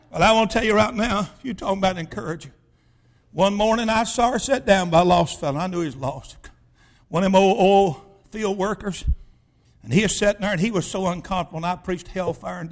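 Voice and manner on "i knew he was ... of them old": ordinary talk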